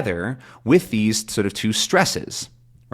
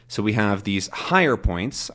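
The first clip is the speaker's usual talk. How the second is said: normal talking voice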